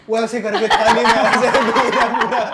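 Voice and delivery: talks through loud laughter; words bursting with laughter; laughing while telling. Real, not acted. Laughing while retelling drunk friend. (हँसते हुए ज़ोर से बोलना)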